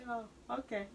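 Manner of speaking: soft, subdued realization; reluctant acceptance. Acted. Cancer admitting the description fits, softly conceding. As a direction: quietly concede after stepping back. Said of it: "take that step back".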